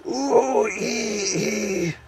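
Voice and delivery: high drawn-out squeal; gnome squeal. A narrator's reading. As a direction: squeal like a frightened little creature. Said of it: "squealed the Earthman".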